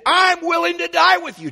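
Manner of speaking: high, loud, boastful Peter; Peter's bold vow. Retold or imitated. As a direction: belt it out like a brash disciple swearing loyalty. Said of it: "He said".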